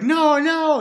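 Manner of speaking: high, loud mock-panicked protest; imitated frightened toddler. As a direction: plead like a terrified little kid, hammed up. Retells his toddler self's terror.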